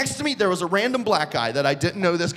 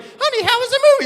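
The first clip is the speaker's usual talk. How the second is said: very high, quick, loud wife voice; mock wife; 7 dB louder, faster than usual. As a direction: chirp it as the wife, pitched way up. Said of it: "his wife was like".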